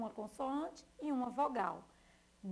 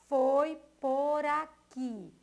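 foi por aqui, drawn out, louder; slow and loud; 3 semitones higher, 8 dB louder, slower than usual. Shown as a demonstration. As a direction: read it out slowly for the class. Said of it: "E a outra frase". (foi por aqui, devagar e alto)

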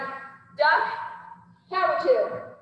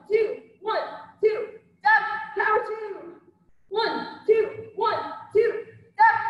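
boxing coach calling combos; 7 semitones higher, slower than usual. Acted. Instructor calling combo reps.

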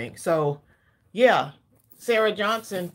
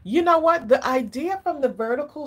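excited; 7 semitones higher, 4 dB louder than usual. Real, not acted. Sudden burst of an idea.

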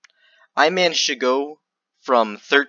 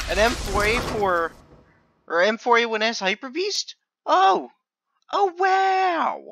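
mock surprise; 10 semitones higher than usual. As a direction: fake amazement at the items. Mock delight at new items.

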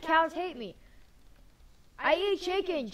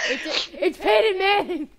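excited shout; 5 semitones higher, 9 dB louder, slower than usual. Real, not acted. Excited at spotting a skin.

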